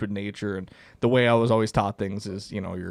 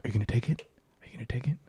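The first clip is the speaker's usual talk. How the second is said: whispered question, pressed twice; urgent whisper; 8 dB quieter, much faster than usual. Retold or imitated. Uncle whispering to the hunter lining up a shot, urgent whisper. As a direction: whisper it so the prey won't hear.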